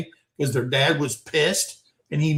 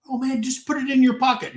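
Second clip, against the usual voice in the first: put-on higher, fast, coaxing kid voice; imitating a pushy friend; 8 semitones higher, faster than usual. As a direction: rush it like a sneaky buddy. Friend urging shoplifting.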